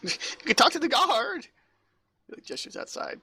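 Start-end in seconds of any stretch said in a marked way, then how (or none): 0.0-1.5 much higher, helpless voice; flustered assistant. Acted. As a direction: offer it in a high underling's voice. Assistant deflects angry visitor.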